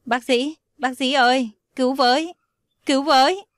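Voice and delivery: frantic shout for help. Acted. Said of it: "vội hét toáng lên". (hét toáng gọi bác sĩ cứu bạn)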